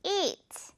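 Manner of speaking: "eat" held long with spread lips; long smile vowel. Shown as a demonstration. Teacher repeating the long smiling eat. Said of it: "길게도 발음해줘야 돼요".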